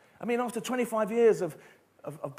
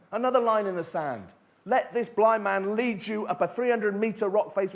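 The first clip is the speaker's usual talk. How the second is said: normal talking voice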